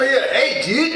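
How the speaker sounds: mock California surfer voice; surfer dude Mikey. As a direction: toss it off like a beach stoner. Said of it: "How does Mikey talk like that?".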